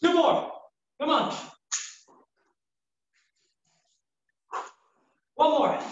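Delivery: high strained shout of reps; coach shouting. Real, not acted. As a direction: drive them to finish. Pushing final reps.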